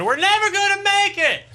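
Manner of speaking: high, loud panicked shriek; screaming wife. Retold or imitated. Husband mimics his wife screaming in the car, high and loud. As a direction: shriek it like she's in labour. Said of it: "she starts screaming".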